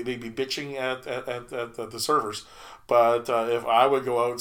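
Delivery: ordinary talk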